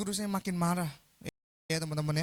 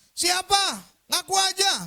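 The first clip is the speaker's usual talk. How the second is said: high, loud demand; angry teacher's shout; 12 semitones higher, 9 dB louder, slower than usual. Retold or imitated. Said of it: "marah dia teriak". (teriakan guru menuntut pengakuan)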